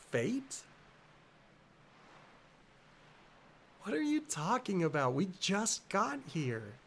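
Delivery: puzzled, chiding friend in a lifted voice; concerned girl game character. Acted. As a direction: fuss over a friend who just passed out.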